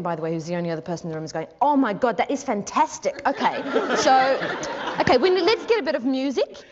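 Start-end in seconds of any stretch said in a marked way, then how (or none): 1.6-6.6 gushing director, high and loud; Jane Campion. Retold or imitated. Director reacting to an improvisation, loud gushing excitement. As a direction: burst out thrilled, voice pitched up. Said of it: "And Jane Campion ... is going".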